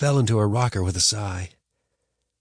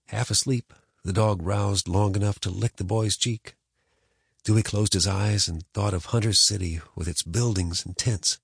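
plain reading voice

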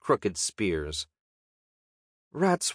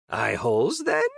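little goblin voice rising sharply into the question; haggling goblin; 2 semitones higher than usual. Acted. Goblin haggles with the knight, creature voice rising.

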